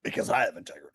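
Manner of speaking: low, soft mock Alex Jones; Alex Jones impression. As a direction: murmur it with false dignity. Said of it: "my new Alex Jones impression".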